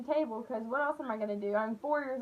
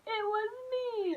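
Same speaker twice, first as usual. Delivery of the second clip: high, drawn-out fake wail; mock crying confession; 8 semitones higher, 3 dB quieter, slower than usual. Kid confessing to Uncle John, mock crying. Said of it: "And I start crying".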